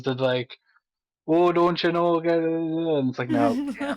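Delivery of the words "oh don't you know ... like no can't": high squeaky mock voice, much higher; a comic high squeak with a croak under it; squeaky strained voice. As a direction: squeeze it up high like a thrashed throat. Mimicking her own wrecked throat.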